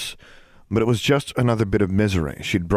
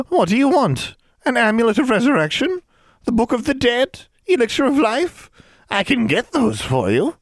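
high, wheedling hag plea; croaking old hag; 4 dB louder than usual. Acted. Hilda pleads with raised hands before the wand, croaking hag. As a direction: beg for mercy in a crone's voice. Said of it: "croaked the old woman".

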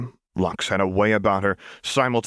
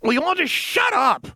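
much higher furious shout; snapped shout; 12 semitones higher than usual. Acted. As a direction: snap at the empty corner. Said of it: "she snapped out".

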